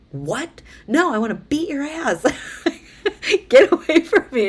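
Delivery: high, loud, furious yell; angry yell. Retold or imitated. To her sister after a fight, furious shout.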